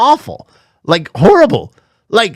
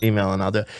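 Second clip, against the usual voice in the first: normal talking voice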